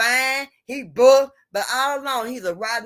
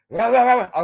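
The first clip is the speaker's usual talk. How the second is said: rough voice; 6 dB louder than usual. Retold or imitated. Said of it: "a rough, rough voice".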